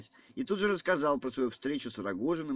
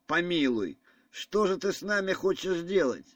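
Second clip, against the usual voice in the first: higher-pitched, dismayed appeal; alarmed protest; 6 semitones higher, 4 dB louder than usual. A narrator's reading. General appeals to Ганя not to back out, alarmed. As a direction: plead in mild alarm. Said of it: "испугался вдруг генерал".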